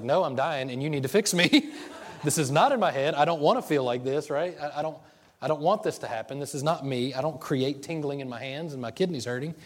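loudly insisting he is dying, half laughing; angry protest. Retold or imitated. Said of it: "it made me very angry".

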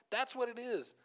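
high-pitched, heartfelt exclamation; overwhelmed wonder. Retold or imitated. Preacher recalling tearful worship, overwhelmed with wonder. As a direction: let the realization lift the voice.

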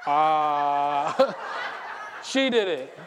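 loud, laughing mock panic; blaming the kid. Pastor imagining blaming his daughter, laughing. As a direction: point at the child in a fake panic. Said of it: "I'd be like".

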